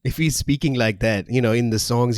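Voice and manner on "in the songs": ordinary talk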